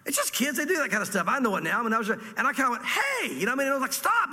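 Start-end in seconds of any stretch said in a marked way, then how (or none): 2.8-3.3 high-pitched, sharp retold "hey"; loud startling call. Man calling out at little kid spitting in shoes, retold loud call. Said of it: "I said it kinda loud". 3.9-4.3 high-pitched retold command to stop; loud stop command. Man telling little kid to stop, retold loud command. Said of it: "I said it kinda loud".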